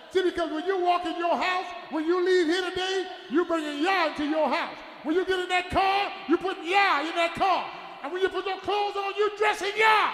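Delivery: ringing pulpit shout; full-force preaching. Real, not acted. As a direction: preach it loud, driving each phrase up.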